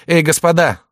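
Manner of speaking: high, loud call to stop. Acted. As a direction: call out across the clearing. Calling out to duelists.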